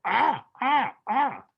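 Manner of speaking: high, squawking bird cries; panicked parrot squawk. Pretend. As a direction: shriek like a frightened parrot at the cat. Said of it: "the parrot will go".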